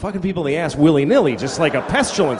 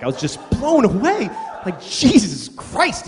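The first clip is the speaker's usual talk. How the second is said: usual speaking voice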